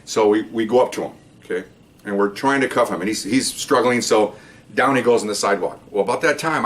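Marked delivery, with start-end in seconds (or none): none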